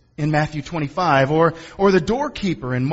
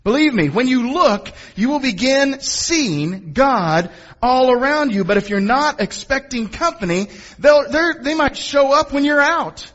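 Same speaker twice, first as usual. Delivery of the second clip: higher, emphatic preacher's delivery; lifted, urgent pulpit voice; impassioned preaching; 11 semitones higher, 5 dB louder than usual. Real, not acted. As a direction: preach it out to the congregation. Preaches at full voice.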